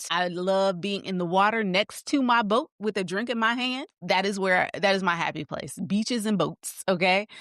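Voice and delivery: ordinary delivery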